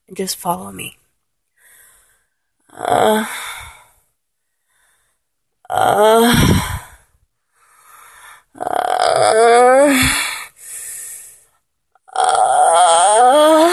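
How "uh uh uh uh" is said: rising vocal fry moans. Shown as a demonstration. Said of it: "use your vocal fry, get a little bit higher".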